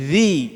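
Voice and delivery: "Zi." with the tongue tip out between the teeth; correct zal sound. Shown as a demonstration. Teacher showing students the correct zal.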